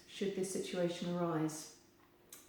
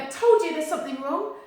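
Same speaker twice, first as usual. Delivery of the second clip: high, loud mock alarm; overdone anxious told-you-so; mock alarmed brain; 8 semitones higher, 10 dB louder than usual. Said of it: "their brain's gonna be going".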